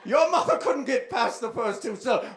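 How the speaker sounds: normal talking voice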